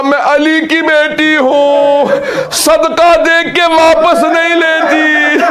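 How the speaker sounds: high, slow, proud grieving declaration; proud defiance. Acted. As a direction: declare it high and slow, head held up in grief. Zainab's defiant reply. (ऊँची, धीमी, गर्वीली घोषणा)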